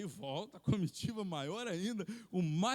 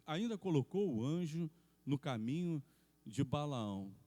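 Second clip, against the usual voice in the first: ordinary talk, nothing marked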